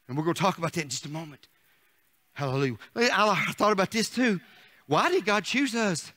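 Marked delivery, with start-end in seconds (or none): none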